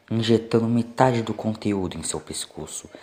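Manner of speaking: ordinary delivery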